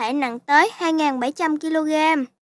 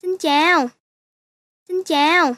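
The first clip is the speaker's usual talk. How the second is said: cute little squirrel saying hello. Pretend. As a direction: speak as a chatty squirrel. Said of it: "Nhìn con sóc như đang nói kìa".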